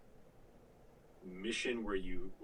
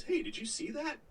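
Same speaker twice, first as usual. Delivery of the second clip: mock guard. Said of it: "one of those".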